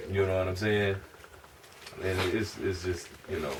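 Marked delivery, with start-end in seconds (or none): none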